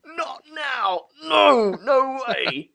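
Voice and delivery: high-pitched mock cries of refusal; panicked inner voices. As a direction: squeal it out in comic dread. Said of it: "a thousand voices in my head went".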